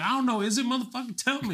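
high-pitched comic indignation; mock exasperation. Visitor replying to questioning Londoner, mock exasperation. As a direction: demand a straight answer.

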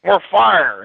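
high, driving coach chant; coach yelling fire. Retold or imitated. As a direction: hammer it like a coach riding a player. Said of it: "he's riding me in a practice".

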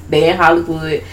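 usual speaking voice

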